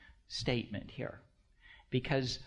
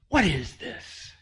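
startled disbelief; 5 semitones higher, 5 dB louder, slower than usual. Retold or imitated. Startled listener woken by radio sermon.

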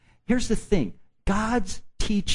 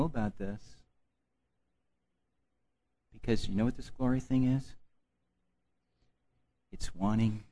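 hushed preaching; 8 semitones lower, 10 dB quieter than usual. Real, not acted. Intimate soft preaching.

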